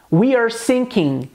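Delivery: We are sinking, very slow, s in sinking hissed clearly. Shown as a demonstration. Teacher modelling the captain's sinking. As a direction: draw out sinking with a sharp s. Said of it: "O navio estava afundando".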